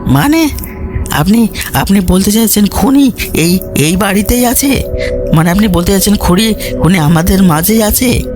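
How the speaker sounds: high, stammering alarm; alarmed questions, pitch jumping up; উদ্বিগ্ন colonel. A narrator's reading. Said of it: "colonel Basu উদ্বিগ্ন চোখে বললেন". (উদ্বিগ্ন, চড়া গলায় প্রশ্ন)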